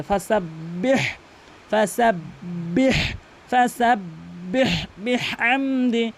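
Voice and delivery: ফাসাব্বিহ ending in strong breathy throat h; slow ফাসাব্বিহ বিহামদি, h released long; correct ha sound. Shown as a demonstration. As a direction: model the pharyngeal h in ফাসাব্বিহ. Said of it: "হার উচ্চারণ করতে হবে".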